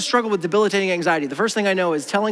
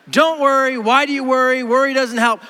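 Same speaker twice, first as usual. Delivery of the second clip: loud, higher, emphatic telling-off; lightly mock a tactless uncle's advice; brusque advice with uncle-like insistence; hint of blunt uncle; 7 semitones higher, 5 dB louder than usual. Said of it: "Does this not sound like your politically incorrect uncle".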